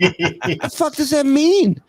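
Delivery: high, drawn-out mock panic; retold scare. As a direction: stretch it out high in comic alarm. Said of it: "and I got scared".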